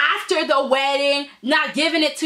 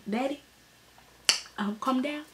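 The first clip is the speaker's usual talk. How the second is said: very soft, hesitant voice; quiet retold plea; gentle calming; 10 dB quieter than usual. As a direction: hush an upset father, carefully. Thorne soothing his father, retold.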